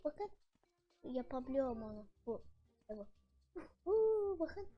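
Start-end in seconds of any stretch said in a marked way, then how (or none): 3.5-4.4 cheer. Real, not acted. Cheering at trick.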